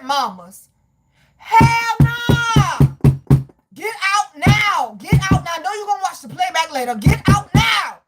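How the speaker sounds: high, loud commands; emphatic, hollered warning; urgent shouting. Real, not acted. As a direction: yell at them to flee right now. Urging viewer to flee relationship.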